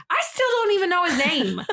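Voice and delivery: high, laughing exclamation; amused aside. Real, not acted. Narrator amused she never learned his name, laughing.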